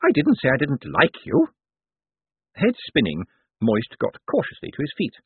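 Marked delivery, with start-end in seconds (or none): 0.0-1.5 put-on old woman's sharp snap, pitched up; snappish old lady. A narrator's reading. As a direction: snap impatiently as an elderly lady. Old lady orders him up.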